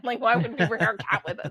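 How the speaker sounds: laughing at an absurd question; half-laughing, incredulous retort; laughing disbelief. Retold or imitated. Answering the neighbor who asked about the cat, laughing incredulously.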